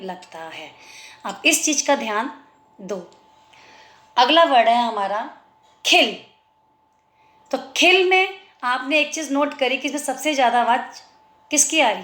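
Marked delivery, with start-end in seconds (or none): 5.8-6.2 "खिल" with a brief i; punchy "खिल" with a short i. Shown as a demonstration. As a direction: say "खिल" quickly, short vowel. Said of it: "ई की sound कम".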